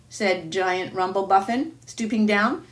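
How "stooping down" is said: usual speaking voice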